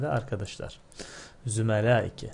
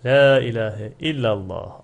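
recitation; 8 dB louder than usual. Shown as a demonstration. Teacher reciting Arabic sentence.

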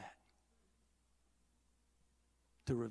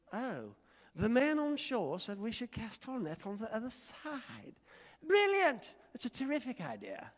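high sneering sarcasm, Brilliant spiked high and loud; mock the advice with a sneer; sarcastic Peter; 8 semitones higher, 6 dB louder than usual. Preacher voicing a grumbling Simon Peter, sarcastic and high. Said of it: "he wasn't real compliant".

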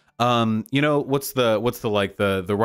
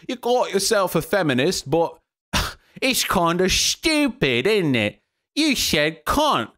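higher, mocking British accent, dragged slow at the end; lampooning a British commentator with a high British accent; 8 semitones higher, slower than usual. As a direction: sneer it like a British pundit, drawing out the last words. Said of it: "I can never do a consistent Brit Bonger accent".

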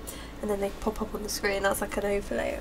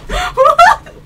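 shrieking, laughing disbelief; surprised; 14 dB louder than usual. Real, not acted. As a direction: squeal it out in shock. Shocked at lyrics, laughing.

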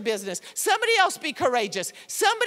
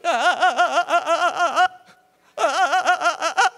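loud, halting gasps of fear pushing forward; frightened panting gasps; 3 semitones higher, 6 dB louder than usual. Retold or imitated. Harriet Tubman gasping in fear while leading people to freedom, loud frightened panting. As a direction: gasp out each step like she is terrified but keeps going.

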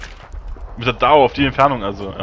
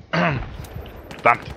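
ordinary delivery

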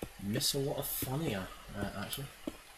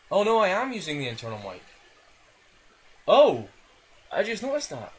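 loud, high outburst of sudden realisation; dismayed exclamation; 9 semitones higher, 16 dB louder than usual. Real, not acted. Gamer just noticed he is using the internal mic, loud dismayed exclamation. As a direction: cry out at your own blunder, pitched well up. Discovers wrong microphone is recording.

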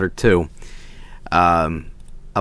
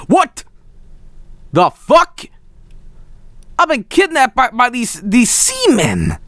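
high-pitched, hammed-up disbelief; exaggerated outraged astonishment, voice leaping up; mock shock; 10 semitones higher than usual. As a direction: squeal it as if suddenly kidnapped. Mock shock at landing on boat.

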